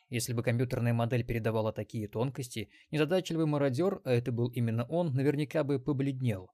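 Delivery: usual speaking voice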